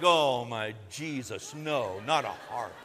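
low, slow, mock horror at a harp; dismayed groan. Tough guys hearing a harp begin, slow mock dismay. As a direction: drag it out like a man who dreads harp music. Men dreading harp music.

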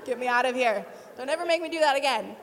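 loud, rushed outburst; comic exasperated begging at full voice; mock desperate plea. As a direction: blurt it out, playing the desperate pupil. Pupil begging out of math class.

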